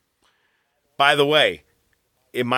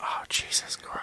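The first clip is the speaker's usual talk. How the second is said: whispering; 13 dB quieter than usual. Real, not acted. Reacting to an offensive joke.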